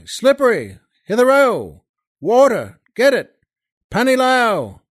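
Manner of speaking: loud, high barking calls across the camp; soldier shouting. Acted. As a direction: yell for the water boy, impatient. Soldiers yelling for water carrier.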